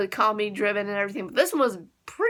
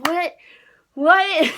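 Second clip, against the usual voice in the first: high, rising to a loud What; shocked disbelief; 6 semitones higher, 7 dB louder than usual. Retold or imitated. Fan reliving the shocking transformation, high and loud. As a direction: blurt out stunned. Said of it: "I'm like".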